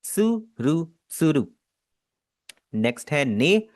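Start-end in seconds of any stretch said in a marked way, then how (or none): 0.0-1.5 त्सुरु with a clipped ts onset and a light tapped r; Japanese word tsuru. Shown as a demonstration. Teacher models the word for crane: careful त्सुरु. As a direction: model त्सुरु slowly for repeating. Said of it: "तो यहाँ पर लिखा हुआ है त्सुरु".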